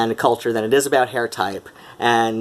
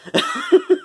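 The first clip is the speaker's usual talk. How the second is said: high laugh. Real, not acted. Laughs at own joke.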